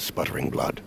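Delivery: grieving hush. Acted. Recalling brother dying in his arms, low hushed grief. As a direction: let the memory drop to almost nothing.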